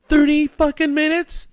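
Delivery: high, loud disbelieving yell; shocked yell. A narrator's reading. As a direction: shout it in horrified disbelief. Wife shocked by timeline.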